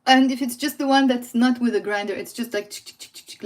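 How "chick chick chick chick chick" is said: whispery, voiceless "chick-chick-chick"; shaker sound. Shown as a demonstration. Teacher describing a pepper shaker without grinder, imitated shaking noise. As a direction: imitate a shaker with soft rhythmic clicks. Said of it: "like that".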